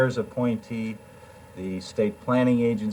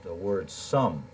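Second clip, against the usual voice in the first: ordinary talk, nothing marked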